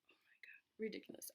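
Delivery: whispered, amused disbelief; exasperated whisper. Real, not acted. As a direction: breathe it out under your breath. Muttering about the dog.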